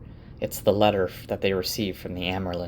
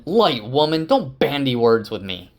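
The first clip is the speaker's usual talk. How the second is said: exasperated; 5 semitones higher, 5 dB louder than usual. Acted. Lan at his breaking point.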